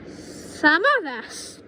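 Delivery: high, slow, lightly throaty; throaty witch voice. Acted. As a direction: lean in and hint darkly. Witch grandma revealing powers.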